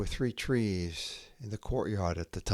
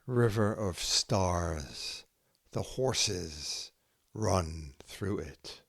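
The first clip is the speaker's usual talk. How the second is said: slow, measured haiku reading with long pauses between poems; poetry reading; slower than usual. Acted. Poet reading her own haiku to listeners, slow and measured. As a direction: let each image land before the next.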